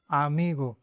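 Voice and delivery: आमीगो said clearly as a-MI-go; example word. Shown as a demonstration. Teacher to class, giving a clear example word. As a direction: model आमीगो with open a and pure o. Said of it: "example देते हैं".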